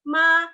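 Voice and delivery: "ma" held long on a high level note; "ma" as one steady sung-level tone; first-tone MA. Shown as a demonstration. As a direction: sustain "ma" high and even, no movement. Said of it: "ton satu itu artinya ibu".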